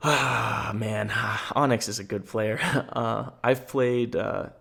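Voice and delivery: usual speaking voice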